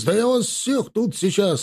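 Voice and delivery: high-pitched blustering threat; indignant officer losing his composure; outraged yelp. A narrator's reading. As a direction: splutter threats in a high put-on voice. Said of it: "завопил гвардеец".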